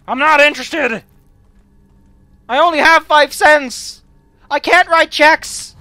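loud, high, frantic refusal; panicked shouting. Pretend. Yelling at a pushy merchant.

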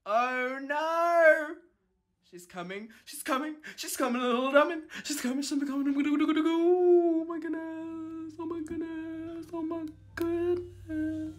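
high, quiet, panicked squeal; hushed panic. Real, not acted. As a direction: squeak it softly as she closes in. Panicking as the beast comes.

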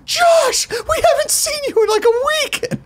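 very high-pitched, squealing mock cry; imitated gushing greeting. Guest imitates the woman behind his local bar greeting him, squealing mock excitement. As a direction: greet a regular in an exaggerated high voice.

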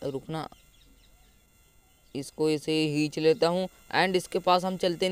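normal talking voice

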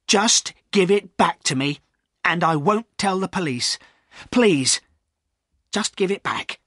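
pleading. A narrator's reading. Silas begging the poacher for his money, pleading. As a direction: beg for it, anxiously.

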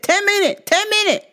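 high, clipped imitation of the Thai restaurant clerk's accent; mock Thai accent. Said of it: "they said".

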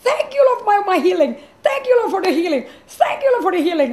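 high, loud ecstatic praise; joyful thanks. Retold or imitated. Healed woman thanking the Lord, high and loud with joy. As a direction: burst out thanking God, overjoyed. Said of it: "I was laughing joyfully".

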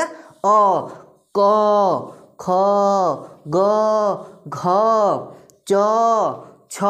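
each of अ, क, ख, ग, घ, च, छ held long; drawn-out letters. Shown as a demonstration. Teacher drilling full letters, each from अ to छ stretched long. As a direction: stretch the final vowel in क, ख, ग, घ, च, छ. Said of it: "खींच रहे हैं हम उस वर्ड को".

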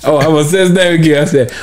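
ordinary delivery

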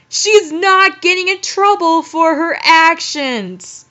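high, loud exasperated rant; outraged rant. Real, not acted. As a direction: vent your disbelief at the character. Outraged rant at story heroine.

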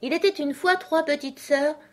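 high-pitched little dormouse voice; hasty dormouse. A narrator's reading. Dormouse starting a story under pressure, hastily. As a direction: begin the tale in a high character voice. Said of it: "commença le Loir en toute hâte".

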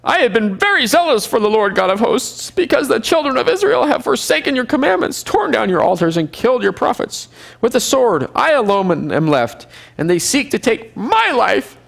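mock the prophet repeating his complaint word for word; hammed-up self-pitying whine; whiny Elijah repeat. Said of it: "he says the same exact thing, word for word".

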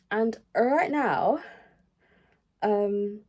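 ordinary delivery

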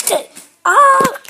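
sudden high cry of alarm; scream. Pretend. Toy car crashes and lets out a high scream. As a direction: cut it off into a yelp.

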